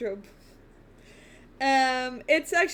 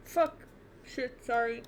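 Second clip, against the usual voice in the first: ordinary delivery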